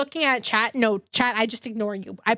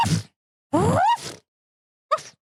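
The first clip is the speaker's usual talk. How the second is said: three short dog barks, barked out through a stuffy nose; congested bark bark; 13 semitones higher than usual. Pretend. Streamer doing the requested bark bark for chat. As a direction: woof like a small dog on request. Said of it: "I'll do the bark bark".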